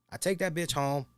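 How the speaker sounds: ordinary delivery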